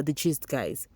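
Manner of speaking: ordinary talk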